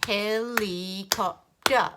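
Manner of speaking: HELICOPTER in four slow, drawn-out chunks; robot talk. Shown as a demonstration. Teacher confirming four beats for children, slow robot HELICOPTER. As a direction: sound out HELICOPTER like a robot, beat by beat. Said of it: "say it slowly like a robot".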